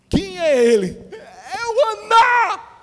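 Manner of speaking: high, loud ringing cry; excited shout. Acted. Villager shouting the name to the crowd, high and loud. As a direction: call out the name like town news.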